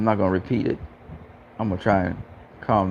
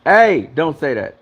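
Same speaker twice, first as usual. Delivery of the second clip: louder, slower "Hey" warning; alarmed protest; 4 semitones higher, 5 dB louder, slower than usual. Real, not acted. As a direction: stop them short, alarmed. Reacting to chat death remark.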